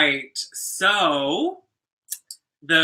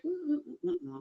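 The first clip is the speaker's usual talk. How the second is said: mock unimpressed hums; 12 semitones higher, 12 dB quieter, faster than usual. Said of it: "it'd be a little bit like".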